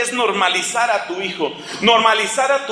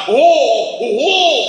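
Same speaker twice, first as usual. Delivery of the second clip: mock horror; 5 semitones higher, 4 dB louder, much slower than usual.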